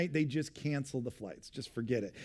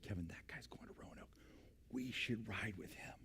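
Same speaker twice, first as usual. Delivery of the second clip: secretive whisper; 7 semitones lower, 19 dB quieter than usual. Retold or imitated. Wife whispering to her husband about the stranger. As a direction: lean in and whisper the scheme.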